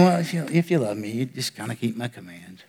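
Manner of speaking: a bored, detached God shrugging off the listener; cold, disinterested. Retold or imitated. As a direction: toss it off like you barely care. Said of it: "cold, disinterested version".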